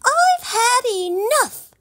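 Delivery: high, loud exasperated snap; angry snap. A narrator's reading. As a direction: snap at the frog, fed up. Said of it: "snapped Poppy".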